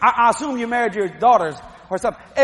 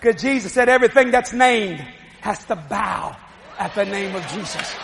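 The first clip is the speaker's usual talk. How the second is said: ordinary talk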